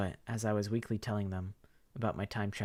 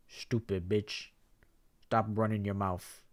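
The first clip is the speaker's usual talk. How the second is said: curt insult; slower than usual. Acted. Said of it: "says Ria to Karma".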